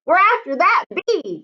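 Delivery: high, changed voice of grandpa; lightly voiced eager grandpa; grandpa's voice. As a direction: tell the boy about the bee. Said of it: "Grandpa said".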